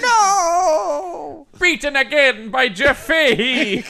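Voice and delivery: high, loud villain wail; hammed-up devil's cry of despair; thwarted devil. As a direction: howl in cartoonish defeat. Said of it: "form the devil's face".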